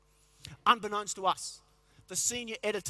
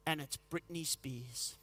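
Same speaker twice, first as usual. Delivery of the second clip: much lower, softer and slow; deflated punchline; 7 semitones lower, 9 dB quieter, slower than usual. Pretend. Hearing [name] on the radio, low deflated. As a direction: sink into defeat.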